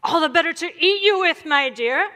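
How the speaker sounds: storybook villain's climactic roar; menacing wolf. A narrator's reading. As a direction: pounce on the line as the hungry wolf. Wolf pounces on the girl.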